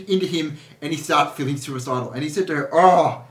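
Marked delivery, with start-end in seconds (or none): 2.7-3.2 loud, higher-pitched mock Scottish complaint; hammed-up Scottish accent, drawn out at the end. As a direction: put on a big Scottish moan. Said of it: "He's Scottish".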